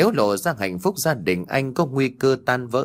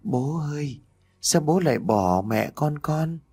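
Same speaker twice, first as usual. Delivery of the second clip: put-on ghost child calling; 5 semitones higher than usual. A narrator's reading. Ghost girl crawling toward her father, eerie child's call. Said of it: "vừa bò nó vừa cất tiếng gọi".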